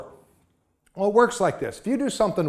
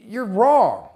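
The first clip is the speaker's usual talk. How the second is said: slow, louder blunt verdict with a falling wrong; firm rebuke; 3 semitones higher, 5 dB louder than usual. Retold or imitated. Speaker telling the auto shop owner he is wrong, firm. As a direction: plant your feet and tell him straight.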